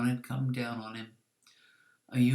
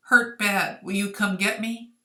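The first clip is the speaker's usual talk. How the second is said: loud, higher pleading plea; 6 semitones higher, 8 dB louder than usual. A narrator's reading. Ayumu posing as hurt Hoki begs Kazu, loud pleading. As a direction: beg for rescue, voice raised.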